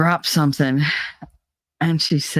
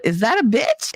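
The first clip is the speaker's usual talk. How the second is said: lightly voiced small child, pitched up; quoted little granddaughter; 7 semitones higher than usual. Grandma retelling her granddaughter's accidental curse, mock child voice. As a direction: ask it higher, like a curious little kid. Quoting granddaughter's innocent question.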